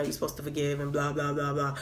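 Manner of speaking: low, soft, hurried trailing list; bored, dropped-low blah-blah; dismissive. Real, not acted. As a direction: wave it away under your breath. Dismissing the duty to forgive.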